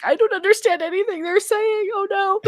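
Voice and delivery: half-joking tearful bewilderment; mock panic. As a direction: play it as a lost tourist's mock despair. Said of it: "and I'm like".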